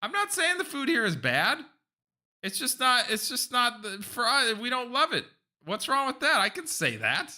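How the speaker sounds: defensive protest. Real, not acted. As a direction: pitch up high, defending himself.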